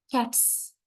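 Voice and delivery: "cats" ending in a voiceless s, "kats"; final s sound. Shown as a demonstration. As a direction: say "cats" with a hissed s at the end. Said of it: "s is pronounced as s".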